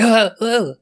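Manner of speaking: high imitated excited gasps; mock overexcited little cries; excited yelps. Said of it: "he got so excited".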